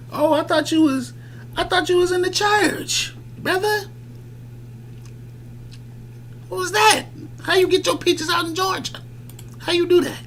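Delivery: squeaky high mocking churchy voice. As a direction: jump way up high to mock him.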